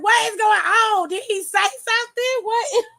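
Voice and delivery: high, laughing what is going on; baffled disbelief. Retold or imitated. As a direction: squeal it out, cracking up in disbelief. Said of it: "And I'm like".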